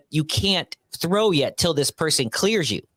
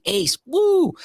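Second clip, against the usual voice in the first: high mock "woo"; celebratory whoop; 13 semitones higher, 4 dB louder than usual. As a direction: whoop as if bragging about an ace. Retold triumphant ace celebration.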